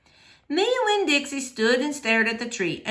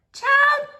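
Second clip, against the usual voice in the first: put-on high drawn-out "Child"; sing-song "Child" from an old lady; Gloria's high call; 5 dB louder than usual. Gloria voiced in read story.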